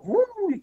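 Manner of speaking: long bruitage aigu qui file; bruitage de voiture. Retold or imitated. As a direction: imiter une auto qui arrive derrière. Imite l'auto qui le colle. (vroum aigu et étiré)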